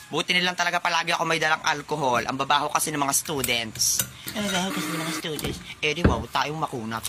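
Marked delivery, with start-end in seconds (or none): 4.3-5.6 mock the teacher under your breath; sneering echo in a put-on voice; mocking mimicry. Student parrots the teacher's complaint.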